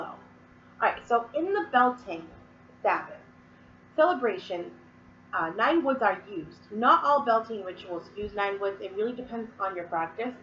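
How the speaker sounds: ordinary talk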